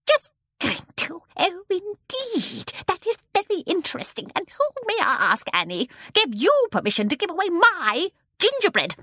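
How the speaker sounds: high, menacing mock politeness; fierce, terrifying. A narrator's reading. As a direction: interrogate a daughter with icy menace. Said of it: "in a soft, fierce, terrifying voice".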